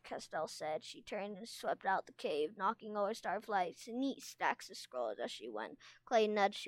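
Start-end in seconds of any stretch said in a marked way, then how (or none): none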